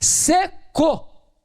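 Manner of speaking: high, hard repeated verdict; mock curt woman, pitched high; glaring wife. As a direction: spit it out like an angry wife. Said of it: "Ela me fuzilou com o olhar e disse".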